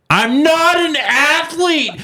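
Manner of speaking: high, slow, whiny mock of his wife. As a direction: whine it back at him the way she did. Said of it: "That's what she says to me".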